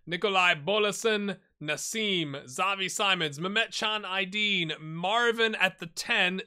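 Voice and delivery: usual speaking voice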